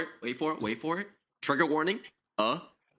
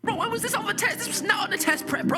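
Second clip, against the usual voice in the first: much higher, squeaky panicked voice; panicked student; 7 dB louder, faster than usual. Acted. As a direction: freak out over a question you never studied. Panicking at the quiz question.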